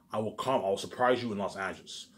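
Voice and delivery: normal talking voice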